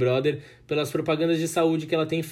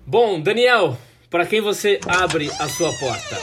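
upbeat host segment intro, louder; announcer voice; 5 semitones higher, 7 dB louder, slower than usual. Acted. Host asks segment question.

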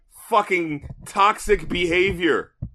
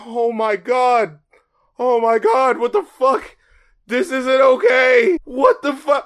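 retold shock; 6 semitones higher, 4 dB louder, slower than usual. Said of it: "And I'm just like".

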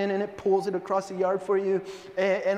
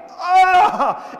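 frustrated scream; 10 semitones higher, 10 dB louder than usual. Retold or imitated. Tissue explodes, he is mad.

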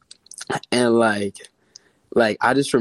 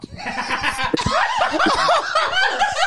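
long high-pitched laughing fit; real shrieking laugh at a shocking reveal; burst of laughter. Real, not acted. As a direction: erupt in high, helpless laughter. Reacting to dad filming reveal.

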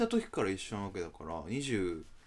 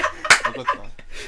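laughing; 4 semitones higher, 13 dB louder, much slower than usual. Real, not acted. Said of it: "teasing question, laughing".

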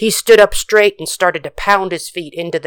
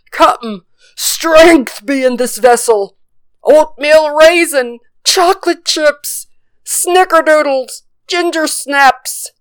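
high, slow, gleeful listing; excited pirate; 10 semitones higher, 3 dB louder, slower than usual. Acted. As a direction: savour each cookie name. Sailor excitedly lists cookies.

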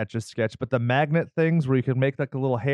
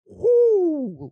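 high swooping whoo sound; rising whoo; 3 dB louder than usual. Retold or imitated. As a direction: sweep the voice up high like a brow arch. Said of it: "like mine if I don't trim them".

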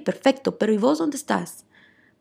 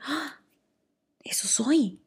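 a hushed, breathless realization; awed whisper; 3 semitones higher, 6 dB quieter, slower than usual. Pretend. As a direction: whisper it like a discovery. Realizing her best self. (susurro asombrado)

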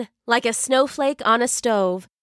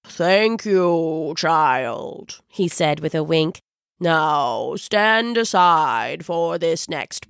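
put-on old wizard voice; 4 semitones lower, slower than usual.

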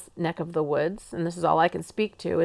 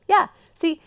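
normal talking voice

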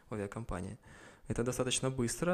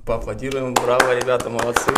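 loud cheer; 14 dB louder than usual. Real, not acted. Cheering the hosts' first episode.

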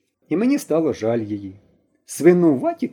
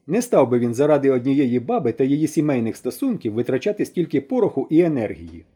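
ordinary delivery

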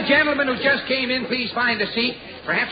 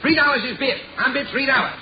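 auctioneer. Acted. Auctioneer calling bids.

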